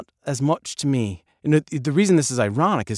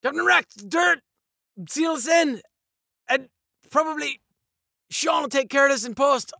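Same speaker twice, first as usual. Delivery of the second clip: acted desperate yelling, pitched way up; high strained shouting; 12 semitones higher, 4 dB louder than usual. Actor yelling to the captain while being buried, high strained shouts. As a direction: yell it high, in broken bursts.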